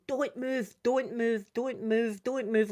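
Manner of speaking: ordinary talk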